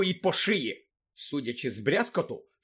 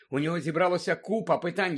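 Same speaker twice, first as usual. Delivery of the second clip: usual speaking voice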